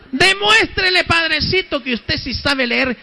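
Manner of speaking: high, pleading woman's voice urging the priest; lightly voiced pushy parishioner; eager churchwoman. Churchwoman goading priest to read.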